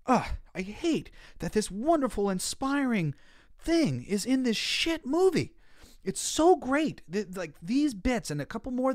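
bursting with enthusiasm, slowing on the swear; overjoyed fan praising the scene, high and loud; excited. Real, not acted. Gushing over a scene he loves.

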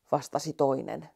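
ordinary delivery

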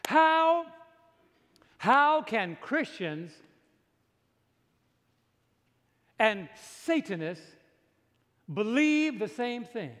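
slow, weighty pulpit delivery building to loud; emphatic preaching. Real, not acted. Preacher challenges congregation about pulpits and demons, emphatic preaching. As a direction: drive each phrase home slowly, rising in force.

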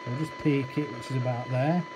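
ordinary delivery

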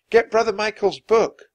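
loud, high urgent order; urgent cry. A narrator's reading. Annie telling Jack to get the book, crying out. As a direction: call it out urgently over a storm. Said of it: "cried Annie".

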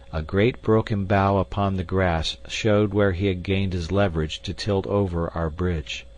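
plain reading voice

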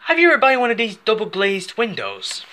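a hint of a doorstep window salesman. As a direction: pitch double glazing like a salesman. Sudden salesman voice in play.

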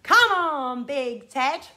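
loud call, stretched first words; loudest voice call. Shown as a demonstration. Showing children how to call Big Ted, loudly. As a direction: call out big, starting strongest. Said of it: "in our loudest voices".

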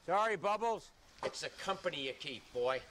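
usual speaking voice

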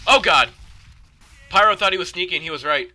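high, loud alarm; high-pitched shouted dismay; panicked yell. Real, not acted. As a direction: yell out as you get ambushed. Ambushed in the game.

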